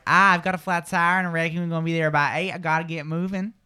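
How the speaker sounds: Southern US accent. Shown as a demonstration. Host models a Southern accent for Natalie to copy. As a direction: talk like someone from the American South. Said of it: "The South".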